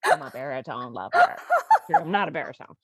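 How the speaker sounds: ordinary talk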